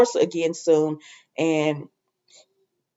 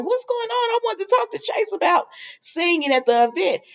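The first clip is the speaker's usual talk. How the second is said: Lucy voiced; 13 semitones higher than usual. Lucy asking Maxie what is going on, in a high put-on voice. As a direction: squeak it out as a puzzled girl. Voicing Lucy's reply.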